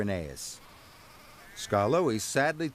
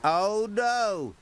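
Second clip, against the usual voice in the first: sad wheeze; 9 semitones higher, 3 dB louder than usual. A narrator's reading. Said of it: "wheezed Rheneas sadly".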